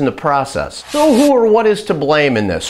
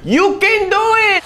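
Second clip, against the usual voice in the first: much higher rallying cry; cheer; 13 semitones higher than usual. Acted. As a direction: shout it like a cheerleader. Cheering viewers on.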